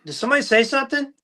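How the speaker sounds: high, fast, puzzled woman's voice; puzzled shopper. Retold or imitated. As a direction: ask it quick and confused, way up high. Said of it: "she's like".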